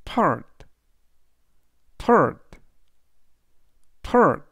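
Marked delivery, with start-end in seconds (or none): 2.0-4.4 "third" said clearly, the th voiceless and the r curled; teacher models "third" for learners to repeat; model pronunciation. Shown as a demonstration. Teacher says the word "third" for students, crisp and deliberate. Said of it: "라고 발음하시면 되겠습니다".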